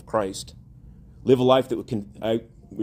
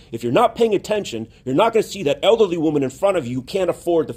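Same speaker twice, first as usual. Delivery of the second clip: higher, louder, driving exhortation; urgent preaching; 7 semitones higher, 5 dB louder than usual. Real, not acted. Preacher warning the congregation against being distracted, urgent. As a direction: press the warning home in a raised, insistent voice.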